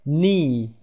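نی with a long, stretched ee; drawn-out vowel. Shown as a demonstration. Teacher showing learners the long vowel, drawn out. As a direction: hold the ee in نی, lips spread. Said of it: "ای کشیده هست".

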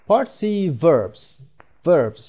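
Part C read very slowly; slow announcing. Shown as a demonstration. Teacher announcing the next section to learners, slow. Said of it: "lesson section heading".

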